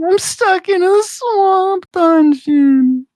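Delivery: silly squeaky voice. Pretend. Joking to his friend in a high cartoon voice. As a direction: stretch each word in a high squeaky whine.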